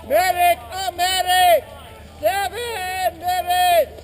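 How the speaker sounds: loud, drawn-out call; man down shout. Real, not acted. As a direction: holler for a medic. Calling for medic, drawn out.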